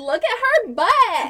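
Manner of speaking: put-on high, slow, drawn-out Valley Girl line. Teasing her partner, she answers with a slow, high Valley Girl line. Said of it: "are we going for, like, Valley Girl".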